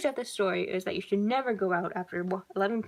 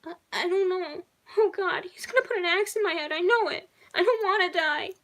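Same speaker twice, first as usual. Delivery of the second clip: high sobbing panic; crying harder; 10 semitones higher, 4 dB louder than usual. A narrator's reading. Bella sobs to the operator, high and desperate. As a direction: sob through the words, high and desperate. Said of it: "She cried harder".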